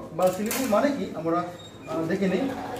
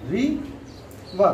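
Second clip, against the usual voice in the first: "রি", long pause, then "বা"; syllable by syllable. Shown as a demonstration. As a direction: split into two separate beats, "রি" and "বা". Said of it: "কয়টি syllable উচ্চারণ করতে পারি".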